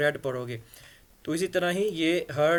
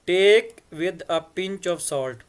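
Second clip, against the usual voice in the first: idiom drawn out very slowly, louder; very slow read; 2 semitones higher, 6 dB louder, much slower than usual. Shown as a demonstration. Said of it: "reads the idiom slowly".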